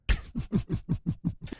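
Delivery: soft breathy villain giggle building up; a quiet giggling laugh that starts to build; maniacal build-up laugh. Acted. Villain taunting viewer, soft maniacal breathy giggle. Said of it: "little maniacal build-up laughter".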